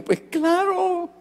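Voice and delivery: a high, stretched "Pues claro" that settles back down; overjoyed, blurting out a promise; excited yes. Retold or imitated. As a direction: son thrilled to promise his father a visit. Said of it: "estaba tan emocionado que le dije".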